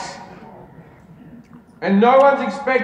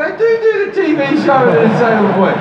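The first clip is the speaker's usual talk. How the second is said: higher, louder, rushed eager reply; starstruck excitement; 7 semitones higher, 5 dB louder, faster than usual. Retold or imitated. Comedian replies to his childhood hero, starstruck and rushed. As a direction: blurt it out like a thrilled fan.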